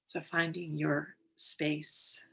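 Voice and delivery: unhurried, calm and measured, settling them in; guided meditation. Real, not acted. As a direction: guide listeners gently into the breath, leaving space between phrases.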